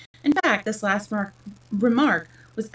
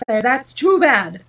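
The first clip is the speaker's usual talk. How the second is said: loud, slow indignant cry; sudden passion; 4 semitones higher, 10 dB louder, slower than usual. A narrator's reading. Humpty Dumpty outraged at Alice's interruption, sudden passion. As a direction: burst out loudly, dragging the words. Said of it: "cried, breaking into sudden passion".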